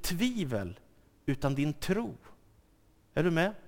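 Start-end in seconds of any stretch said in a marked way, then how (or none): none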